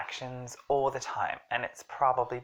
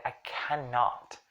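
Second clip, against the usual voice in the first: slow, heavily weighted "cannot"; "cannot" said slowly with full stress; emphatic CANNOT; slower than usual. Shown as a demonstration. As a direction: draw out both syllables of "cannot". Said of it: "I'm really emphasizing my inability".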